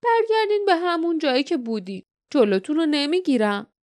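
high-pitched, theatrical villainous guardian; mocking guardian. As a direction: play the guardian sending intruders away, pitched high. Guardian dismisses the travellers.

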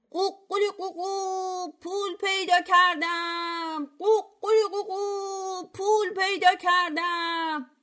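high, drawn-out sing-song rooster crow; خروس crowing. A narrator's reading. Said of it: "شروع کرد قوقولی قوقو کردن".

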